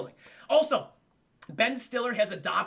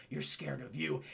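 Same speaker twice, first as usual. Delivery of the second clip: low, soft acted verdict; pointed quiet reply; 6 semitones lower, 6 dB quieter than usual. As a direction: deliver it low, as the calm challenger. Quiet reverse psychology reply.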